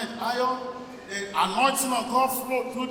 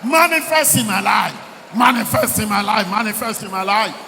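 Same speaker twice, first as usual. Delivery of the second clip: shouted prayer declaration; 7 dB louder than usual. Real, not acted. Preacher leads the congregation in prayer, shouted. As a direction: proclaim it forcefully, then repeat insistently.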